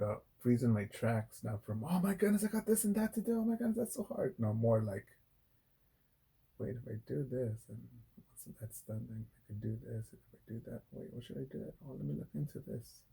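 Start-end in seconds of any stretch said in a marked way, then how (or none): 1.8-4.3 much higher, hammed-up fretting voice; mock panicky whine. Mocking his own panic. 6.6-13.0 softer, thinking-aloud murmur; quiet inner planning. Retold or imitated. Voicing his calm planning thoughts.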